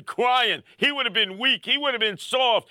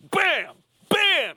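punch sound effects; 8 semitones higher than usual. Retold or imitated. Acting out the Mexican guy's punches, imitated punch sounds. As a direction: make the punches land with your voice.

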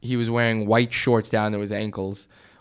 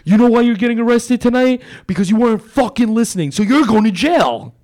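higher, louder barked cop voice; yelling cop; 8 semitones higher, 5 dB louder than usual. Retold or imitated. Cop yelling at a handcuffed girl on the sidewalk, loud. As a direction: shout it down like an angry cop.

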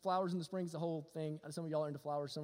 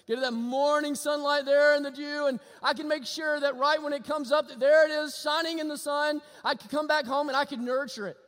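louder, higher pulpit build; full-force preaching; 6 semitones higher, 8 dB louder than usual. Acted. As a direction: drive it home to the congregation.

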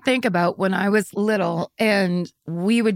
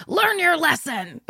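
mock stern dad; 8 semitones higher, slower than usual. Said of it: "it's basically like".

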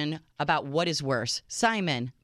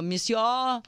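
mock French; 5 semitones higher than usual. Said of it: "What do I say?".

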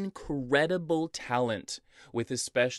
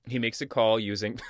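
ordinary talk

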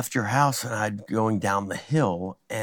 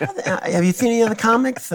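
higher, lightly mocking Jay voice; imitating Jay; 10 semitones higher than usual. Jay greets the comic in a higher mock voice. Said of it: "And he's like".

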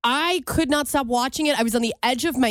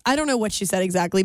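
normal talking voice

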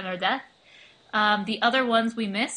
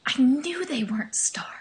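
whisper; 3 semitones higher than usual. Acted. Secret aside, whispered.

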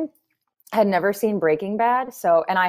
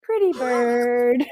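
slower, drawn-out parrot call; 3 semitones higher, much slower than usual. Retold or imitated. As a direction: stretch it like a squawking bird. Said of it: "The gas man".

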